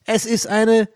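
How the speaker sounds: high, pumped show announcement; hyped announcer. Acted. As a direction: build up like a hype man. (aufgedrehter Ansager)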